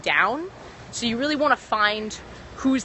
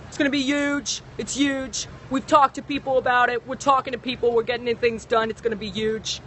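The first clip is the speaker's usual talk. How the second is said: Donald Trump impression; 3 semitones higher than usual. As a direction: boast like a showman politician. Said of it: "I can do a good Donald Trump".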